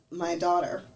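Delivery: usual speaking voice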